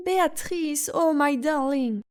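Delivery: put-on swooning baby-talk to a beloved dog; doting cry. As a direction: melt with adoration for the poodle. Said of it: "elle s'écria".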